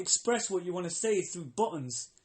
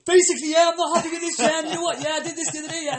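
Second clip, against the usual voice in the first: much higher, louder babbling complaint; mock rambling caller; 11 semitones higher, 11 dB louder, faster than usual. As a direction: gabble a garbled complaint.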